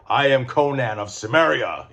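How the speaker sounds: Khazar voice. Shown as a demonstration. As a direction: proclaim it as the Khazar-style Conan. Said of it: "my Khazar voice".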